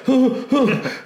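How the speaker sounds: mock alarmed cry. Herded audience yelping in mock alarm. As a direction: yelp like a startled guest.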